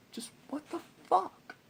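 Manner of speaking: incredulous high whisper; mock-dumbfounded whispered high. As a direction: breathe it out in high, stunned disbelief. Replaying his dumbfounded reaction.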